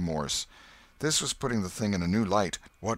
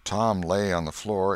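usual speaking voice

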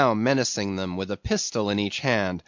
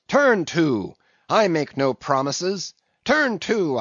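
loud, high commanding shouts; a captain shouting down his crew; barked orders; 6 semitones higher, 3 dB louder than usual. Acted. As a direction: order them back to work, no arguing.

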